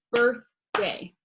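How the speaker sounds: "birthday" slowly in two separate beats; split into syllables. Shown as a demonstration. Teacher shows class clapping syllables, "birthday" split in two. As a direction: clap out "birthday" with a gap between birth and day. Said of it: "we're used to clapping".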